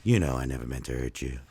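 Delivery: very low, quick mock-crooner line; deep talking voice. Joker pleading with a baby he hurt, deep voice. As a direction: drop deep, as a put-on smooth talker. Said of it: "the deep talking voice guy".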